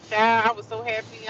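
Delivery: ordinary talk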